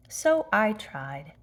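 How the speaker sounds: plain reading voice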